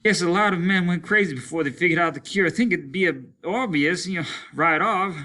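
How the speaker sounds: fast, high, loud words in running rhythm; breathless running cadence; panting in cadence. Acted. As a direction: pant it out to your stride. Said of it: "Simmons panted, the words in cadence to his running".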